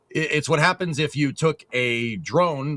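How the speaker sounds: ordinary talk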